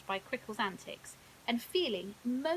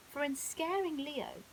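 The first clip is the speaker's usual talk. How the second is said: usual speaking voice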